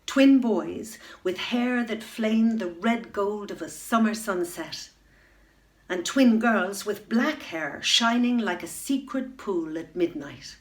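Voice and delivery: plain reading voice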